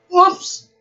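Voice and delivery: high, loud whoops; storyteller reacting to the fall. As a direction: yelp as she trips. Pat trips.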